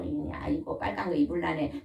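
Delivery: ordinary talk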